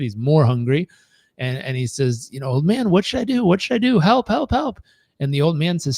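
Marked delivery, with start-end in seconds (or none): 2.5-4.8 high-pitched mock plea for help; storyteller's high, urgent begging; desperate pleading. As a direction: plead like a panicked boy in a tale. Exhausted boy begs old man.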